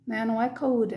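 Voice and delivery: usual speaking voice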